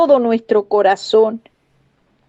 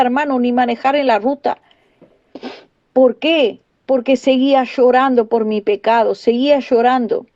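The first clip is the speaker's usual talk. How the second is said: usual speaking voice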